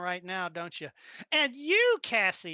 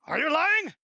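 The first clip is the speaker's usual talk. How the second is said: high, loud accusing question; mock suspicious; 9 semitones higher, 8 dB louder than usual. GM catches the wink.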